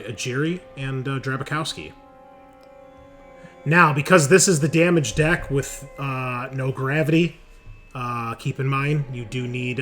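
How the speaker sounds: ordinary delivery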